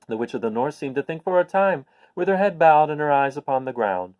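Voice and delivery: ordinary delivery, nothing marked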